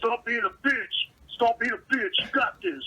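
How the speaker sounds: loud, slow bro yelling at himself; mock gym bro hype. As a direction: pump yourself up like a meathead lifter. Gym guys hyping each other.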